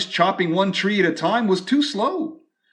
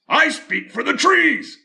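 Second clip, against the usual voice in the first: high, loud bossy cry; cried out; 9 semitones higher, 4 dB louder than usual. Acted. Lorax insisting he speaks for the trees, high cry. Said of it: "cried the Lorax".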